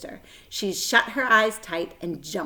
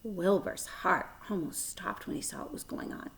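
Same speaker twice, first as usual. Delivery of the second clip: hushed dread; 9 dB quieter than usual. A narrator's reading. Tense narration.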